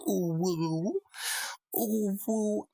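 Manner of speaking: high, wavering mock ghost wail; hammed-up haunted-house ooh; spooky ghost noise. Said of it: "I don't know where that came from".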